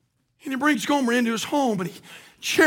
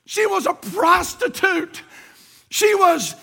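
full-force preaching; 5 semitones higher than usual. Acted. Pastor exalting the husband's mercy at full preach. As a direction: preach it to the rafters.

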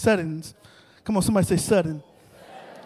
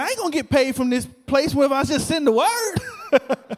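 higher-pitched, laughing protest; mock protest; 5 semitones higher than usual. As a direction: imitate an indignant friend objecting, amused by it. Friend protests he will not get paid.

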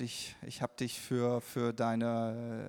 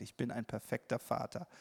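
inner affirmation; 3 semitones lower, 6 dB quieter than usual. Retold or imitated. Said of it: "Gott hat's gesagt".

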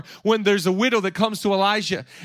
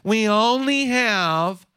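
slow, drawn-out whiny voice; exaggerated slow whine; whining; 2 semitones higher, much slower than usual. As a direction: stretch it out like a sulking child. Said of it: "the complaining and the whining".

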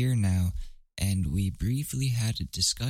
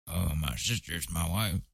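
mocking quiet boyfriend voice; 2 semitones lower, 6 dB quieter than usual.

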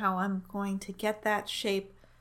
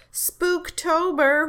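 slow, high, loud spooky drawl; spooky drawn-out; 8 semitones higher, 9 dB louder, much slower than usual. Pretend. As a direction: stretch it out like a ghost. Spooky Halloween hype.

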